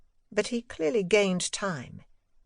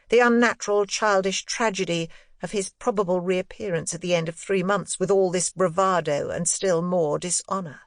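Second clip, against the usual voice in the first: usual speaking voice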